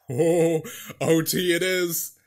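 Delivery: normal talking voice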